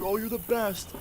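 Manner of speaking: high, imitated gush of thanks; mock-grateful friend; gushing gratitude. Friend gushes thanks after relenting.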